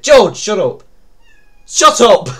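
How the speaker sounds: high, loud yell at George; sudden loud, high scolding; exasperated shout. Real, not acted. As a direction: shout him down sharply.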